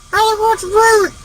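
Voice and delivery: very high squawking bird voice; parakeet squawk. Acted. Smuggled parakeet interrupts the pilot's chat, high squawk.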